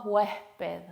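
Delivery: "huésped" with a long, overdone h for the s; exaggerated aspirated S. Shown as a demonstration. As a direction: slowly exaggerate the puff of air replacing s in "huésped". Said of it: "Bueno, no tan exagerado".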